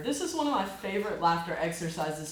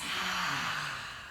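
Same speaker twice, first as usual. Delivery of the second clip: soft falling exhale; releasing sigh; 5 semitones lower, 7 dB quieter than usual. Shown as a demonstration. Guiding breathing, soft sigh. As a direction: breathe it all out. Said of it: "And release".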